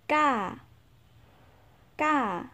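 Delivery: ca said high and rising sharply; Lao tone demo. Shown as a demonstration. Teacher showing learners the Lao tone on ca. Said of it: "Không phải là ca".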